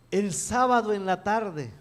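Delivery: normal talking voice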